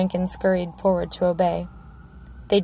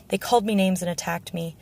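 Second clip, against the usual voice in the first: ordinary delivery, nothing marked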